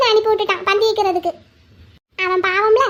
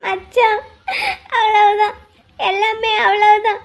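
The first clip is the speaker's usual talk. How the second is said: tearful drawn-out lament; crying; slower than usual. Acted. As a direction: cry as you say it, stretching the end. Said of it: "எதுக்கு மச்சி அழுகுற". (அழுதபடி இழுத்துப் பேசுதல்)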